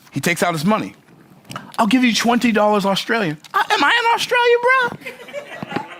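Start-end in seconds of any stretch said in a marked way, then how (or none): 1.8-3.4 put-on lightly done Australian accent, pitched up; a playful Aussie offer; hint of an Australian accent. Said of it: "my Australian accent's not that good". 3.5-5.9 disbelieving, pitched way up, with a laugh; mock outrage with a laugh; incredulous. Mocking the Australian dollar offer.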